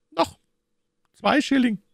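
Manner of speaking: put-on high-pitched child calling out; little boy calling. A narrator's reading. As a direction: pipe up eagerly as a small boy. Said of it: "rief der kleine Tunichtgut rasch".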